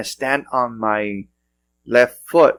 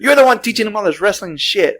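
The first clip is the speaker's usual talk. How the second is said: high, louder mock wife accusation; wife blaming; 11 semitones higher, 4 dB louder than usual. Host imitates wife blaming him, high mock accusation. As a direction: pin the blame on him like the wife. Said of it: "she looks at me".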